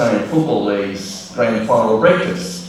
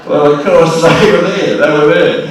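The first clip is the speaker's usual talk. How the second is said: emphatic; 7 semitones higher, 8 dB louder than usual. Real, not acted. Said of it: "emphatic retelling".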